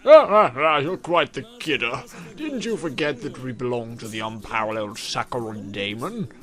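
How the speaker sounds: loud oily laugh, slow smarmy lines; old detective smarmily brushing off the suspect; sleazy old detective. Acted. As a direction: purr it like a greasy cop.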